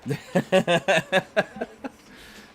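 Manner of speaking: real high-pitched burst of laughter; giggling fit. Real, not acted. Laughing at the impression.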